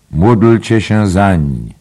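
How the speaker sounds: loud, drawn-out plea for prayer; Dollard's praying. A narrator's reading. Dollard begs listeners to pray for him, loud praying. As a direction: raise it high and pray slowly. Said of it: "modlił się bas Dolarda".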